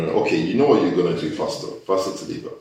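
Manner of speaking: usual speaking voice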